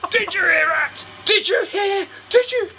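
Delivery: mock breaking down. Salesman pleads with his colleague, mock tearful rising wail. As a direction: imitate a grown man crying. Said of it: "And then he just broke down".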